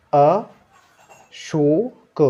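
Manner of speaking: Ashok stretched very slowly, each sound held; drawn out. Shown as a demonstration. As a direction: draw out Ashok very slowly, rising. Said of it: "slow drawn-out demo before blend". (बहुत खींचकर धीरे-धीरे)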